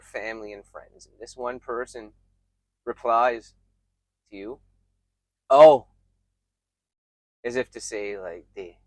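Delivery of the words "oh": loud, abrupt "Oh"; acted dismissive one-word reply; unimpressed reply. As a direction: say it loudly, as if not impressed. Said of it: "Am I supposed to be impressed".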